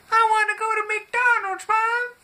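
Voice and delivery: put-on very high, loud little-kid yell; whiny seven-year-old. Kid in the back calling to mom on a family trip, high childish yell. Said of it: "the seven-year-old in the back says".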